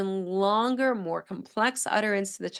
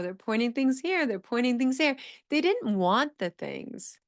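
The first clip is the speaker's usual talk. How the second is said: ordinary delivery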